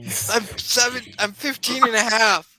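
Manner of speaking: put-on high, loud indignant kid; Tony, indignant. Game master as Tony correcting someone about his age, indignant.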